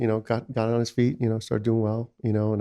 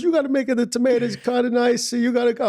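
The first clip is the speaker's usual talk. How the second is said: high, louder mock mother lecturing; imitating his bossy mom; 13 semitones higher, 5 dB louder than usual. Mother telling son how to cut tomatoes, high loud mock voice. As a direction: lay down mom's rules, pitched high and pushy.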